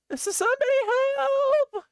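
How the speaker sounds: high, loud cry for help; calling for help. Acted. Girl calling for help over the fallen lawyer, high and loud. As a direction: shout for anyone to come.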